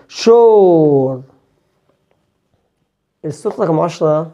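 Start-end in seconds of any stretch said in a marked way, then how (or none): 0.1-1.2 شور said slowly as "shor"; "or" sound. Shown as a demonstration. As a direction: stretch شور into a long "or". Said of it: "بقولها اور". (شور تتنطق أور)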